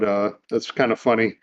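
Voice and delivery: normal talking voice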